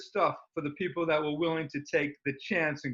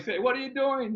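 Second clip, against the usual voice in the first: alarmed outburst; 10 semitones higher than usual. Retold or imitated. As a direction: jump up in pitch, startled by the doctor. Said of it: "So I'm like".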